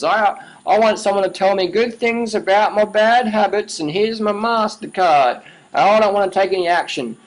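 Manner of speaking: mock the excuse-maker in a put-on pleading voice; mocking a listener who wants praise for bad habits, in a whiny put-on voice. Mocking people who want validation.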